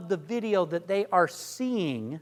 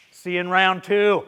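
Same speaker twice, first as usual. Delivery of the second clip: confident, louder assumptive close; cocky; 7 dB louder than usual. Shown as a demonstration. Coach demos a cocky close to the pitcher. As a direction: say it like you already won. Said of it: "Just kind of the cocky".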